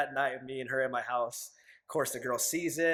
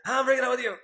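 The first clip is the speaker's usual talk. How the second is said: much higher and louder, blurting it out; blurted breakup; 10 semitones higher, 8 dB louder, faster than usual. Retold or imitated. Tells his girlfriend after her baptism, blurting it loudly. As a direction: blurt it out all at once, no tact.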